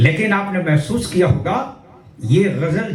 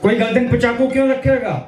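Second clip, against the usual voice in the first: usual speaking voice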